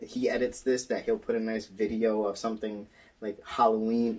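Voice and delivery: ordinary talk, nothing marked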